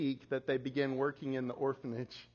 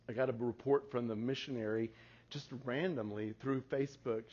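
ordinary delivery

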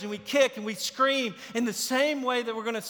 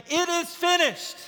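lifted higher, a ringing final cry; 7 semitones higher than usual. Retold or imitated. Preacher voicing Jesus's last words, high cry. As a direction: ring it out high. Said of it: "the sound of him say".

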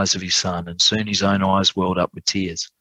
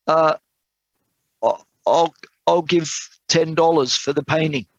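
halting, higher-pitched bid; hesitant gardener; 8 semitones higher than usual. A narrator's reading. Poor gardener bids from the back of the room, halting and high. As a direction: stumble into the offer with long hesitations.

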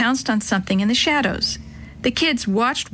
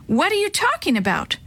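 high shocked question; startled, caught off guard; 9 semitones higher than usual. A narrator's reading. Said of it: "Chris looked shocked".

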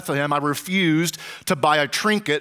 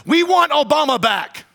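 loud, high mock protest cry; imitated yelling; 9 semitones higher, 5 dB louder than usual. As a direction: shout it as the seller's comic punchline. Imitates the yelling street seller.

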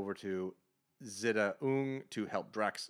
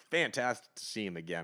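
usual speaking voice

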